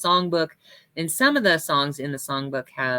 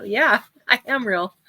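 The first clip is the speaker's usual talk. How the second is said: higher-pitched reply broken by a laugh; amused retort; 7 semitones higher, 4 dB louder than usual. Retold or imitated. Pastor retelling her answer to the chaplain, amused and laughing.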